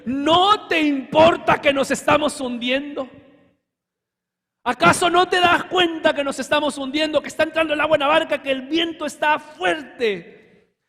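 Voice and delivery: loud, high pleading shout; panicked disciples. Retold or imitated. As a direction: cry out as the boat fills with water. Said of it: "le gritaron".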